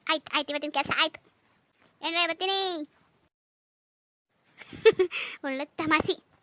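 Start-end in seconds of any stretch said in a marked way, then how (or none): 4.6-5.4 loud breathy laugh; laughter. Acted. Laughing at the good joke, loud breathy laugh. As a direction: laugh off the whole scene.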